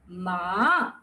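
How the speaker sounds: "ma" dipping low, then rising; third-tone "ma", long low scoop upward; falling-rising third tone. Shown as a demonstration. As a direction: draw "ma" down low and lift it at the end. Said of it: "The third tone is a rise and fall".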